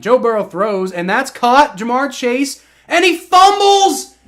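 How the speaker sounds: rising to a shout on the fumble; calling the play, then horror at the fumble; excited play-call. Real, not acted. Streamer calling his team's play, rising excitement to shout.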